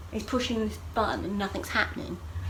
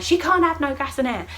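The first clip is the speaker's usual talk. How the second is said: raised, louder retold objection; arguing doctor; 7 semitones higher, 6 dB louder than usual. Doctor arguing with another doctor, raised emphatic refusal. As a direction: act out a firm refusal.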